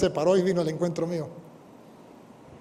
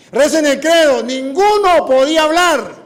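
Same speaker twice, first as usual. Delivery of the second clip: commanding call; 10 semitones higher, 10 dB louder, slower than usual. Retold or imitated. Desafía a los carismáticos.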